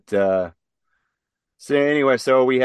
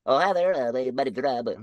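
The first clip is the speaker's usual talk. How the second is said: higher, light mock friendly greeting; bland guy voice; 5 semitones higher than usual. Teasing plain names with the interviewer, higher mock friendly greeting. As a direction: chirp it like a harmless suburban guy. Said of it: "Bob, Kevin, Rob".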